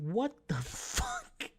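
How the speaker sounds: high, drawn-out disbelieving cry; retold shocked outburst. Watching the changed movie scene, loudly incredulous. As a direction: stretch it out in high-pitched disbelief.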